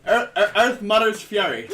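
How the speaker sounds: stumbling, loud Irish accent; retry the name in an Irish accent. Shown as a demonstration. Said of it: "my attempt at an Irish accent".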